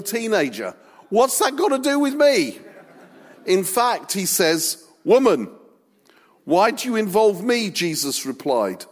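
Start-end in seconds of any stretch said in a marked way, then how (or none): 1.1-2.6 put-on high, loud teenage retort; mock teenage backchat; stroppy teenager. Jesus voiced as a normal teenager. 5.0-7.7 put-on teenage retort. Jesus voiced as teenager.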